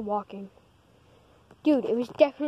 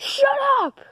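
shout; 8 dB louder than usual. Acted. Telling the beeper to stop.

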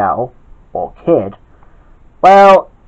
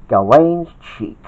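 usual speaking voice

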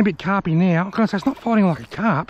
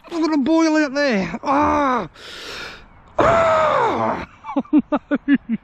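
high, slow, rising to a shriek; real excited yells; excited cries; 8 semitones higher, much slower than usual. Real, not acted. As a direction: shriek as the big fish thrashes and escapes. Fish boils, then gets off.

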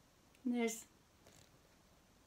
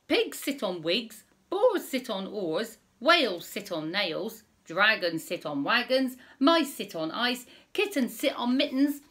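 put-on reading to children; 2 semitones higher, 6 dB louder than usual. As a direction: read it out big for little listeners. Reading rhyming story to children.

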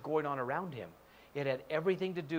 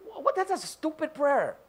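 ordinary delivery

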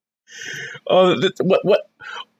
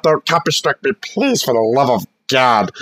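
normal talking voice

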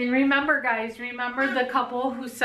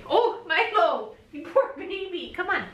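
cooing sympathy; 9 semitones higher than usual. Real, not acted. Owner consoling her dog Milo, cooing sympathy.

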